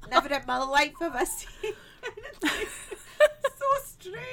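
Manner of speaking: put-on Scottish-accented old fairy, laughing and climbing high on so strange; mock Scottish fairy. A ninety-five-year-old fairy in a laughing, mock Scottish accent. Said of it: "That was my fairy".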